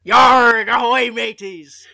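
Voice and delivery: high, loud, drawn-out pirate growl; hammy pirate shout, slow on ahoy. Pretend. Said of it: "The pirate voice is back".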